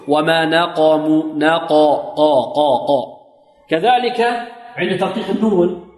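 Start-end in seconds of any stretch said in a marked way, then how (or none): 0.1-3.0 slow نقموا with a dark, full قا; heavy open qaf. Shown as a demonstration. Teacher drilling the heavy letter, emphatic open qaf. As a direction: repeat قا with deep emphatic resonance. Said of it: "الحرف المفخم مفتوح".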